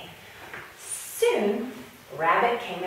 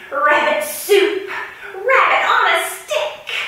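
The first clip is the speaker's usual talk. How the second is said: rising louder and higher with each dish; greedy build-up; 11 semitones higher, 6 dB louder than usual. Acted. Hungry lion drooling over his rabbit menu, building excitement. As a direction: savour each dish more than the last.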